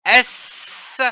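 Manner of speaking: a long unvoiced hiss for S; sustained S hiss, no voice; hissed S sound. Shown as a demonstration. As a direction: hiss the S through your teeth. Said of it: "afin de voir le son".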